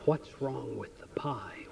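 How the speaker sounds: a hushed, serious waitress whisper. Retold or imitated. Waitress leaning in to ask about the pie, whispering. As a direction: breathe it low like it's a secret.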